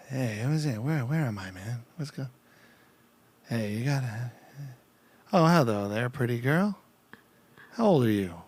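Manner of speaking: soft, halting words that peter off; Biden. Acted. Comedian trying out an impression for the host, Biden mumble. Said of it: "let me try my Biden for you".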